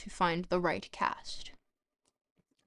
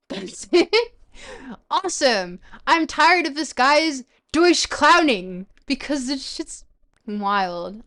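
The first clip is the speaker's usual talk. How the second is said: high, loud laughing rant; gleeful; 5 semitones higher, 7 dB louder than usual. Real, not acted. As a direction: crow it out with glee. Gleeful rant about the author.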